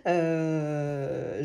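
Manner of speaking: normal talking voice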